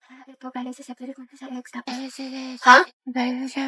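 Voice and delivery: near-silent explanation to a classmate; barely audible voice. Acted. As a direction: speak so softly nobody can hear. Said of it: "Sobrang hina ng boses mo".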